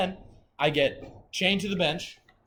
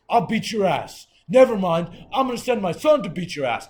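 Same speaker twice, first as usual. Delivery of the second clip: loud, higher imitation of a threatening guard; officer's threat; 5 semitones higher, 10 dB louder than usual. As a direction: threaten him loudly as the jailer.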